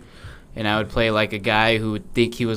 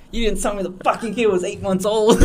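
higher, laughing doctor complaint; laughing mock doctor; 9 semitones higher than usual. Friend playing the doctor, laughing at the joke.

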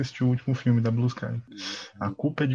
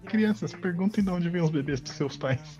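Robin voice; 6 semitones higher than usual. Retold or imitated. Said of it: "que o Robin fala".

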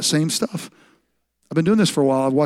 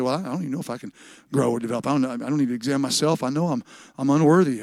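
quiet, low voicing of a self-doubter; doubting churchgoer; 5 semitones lower, 5 dB quieter, faster than usual. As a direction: mumble quick excuses, softly. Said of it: "You say".

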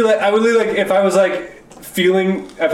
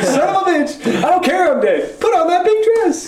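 high, hammed-up indignant voice, much higher and slower; dead friend; 13 semitones higher than usual. Dead friend scolding his buddy from beyond, high mock outrage. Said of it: "he'd be like".

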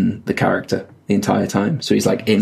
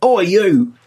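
high British "oi" called out in imitation; hammed-up British heckle, pitched high; mock angry British accent; 11 semitones higher, much slower than usual. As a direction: imitate a Brit picking a fight. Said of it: "British accent".

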